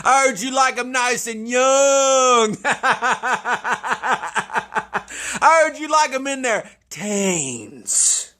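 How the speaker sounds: high, slow taunting call; taunting sing-song. Real, not acted. Taunting EDP, high and slow between laughs. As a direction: jeer at him in a high drawn-out voice.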